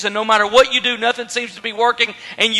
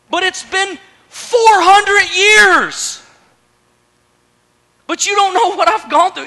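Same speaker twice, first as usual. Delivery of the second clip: high-pitched, drawn-out objection; acted exasperated complaint; anguished protest; 7 semitones higher than usual. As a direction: plead your case with raised voice. Acted quarrel, Zechariah protesting.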